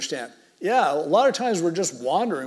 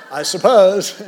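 laughing; 3 semitones higher, 11 dB louder, slower than usual. Real, not acted. Laughing at son's funny question.

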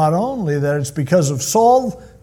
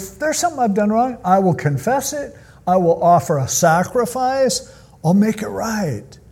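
pleading; 7 semitones higher than usual. Retold or imitated. David pleading with Saul.

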